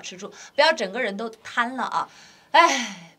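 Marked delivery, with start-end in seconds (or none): 2.5-3.1 loud slumping sigh; heavy careless sigh; collapsed sigh. Shown as a demonstration. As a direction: sigh like you've given up. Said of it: "不要这样子".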